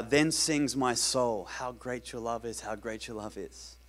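slow, very soft quoting; hushed lyric recital. Retold or imitated. Preacher quoting a worship song to the congregation, slow and hushed.

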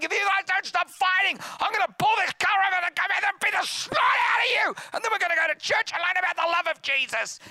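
high, loud hammed-up parental rant; furious dad. Pastor dad driving fighting kids to church, furious yelling. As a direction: yell threats at the kids, building to exasperation.

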